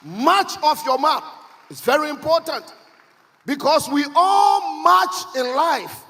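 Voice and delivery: loud, high sermon storytelling; emphatic preacher's delivery, building in force; full-force preaching. Real, not acted. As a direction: preach it out to the whole congregation. Preacher tells story, drives home message.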